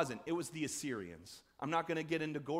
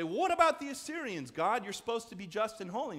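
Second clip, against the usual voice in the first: raised, pleading challenge to God; indignant protest; 6 semitones higher, 5 dB louder than usual. Retold or imitated. People in exile protesting to God, a raised indignant cry. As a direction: cry out at God about the unfairness. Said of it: "And they say".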